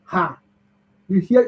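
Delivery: ordinary talk, nothing marked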